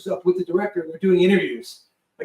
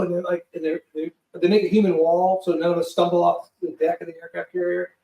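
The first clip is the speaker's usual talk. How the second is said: ordinary talk, nothing marked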